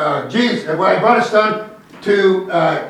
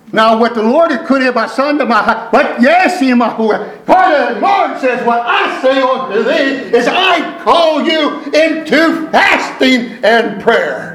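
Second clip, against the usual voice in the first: slow, forceful prophetic proclamation; impassioned pulpit declaration, phrase by phrase; fervent preaching; 5 semitones higher, 3 dB louder, slower than usual. Real, not acted. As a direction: proclaim it as a word from the Lord. Preaches a word from the Lord.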